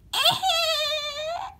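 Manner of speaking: very high, long-held giggly squeal; eh-heh squeal. Shown as a demonstration. Red Yoshi shows Mario his old catchphrase, high squeal. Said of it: "Can you still do the eh-heh thing?".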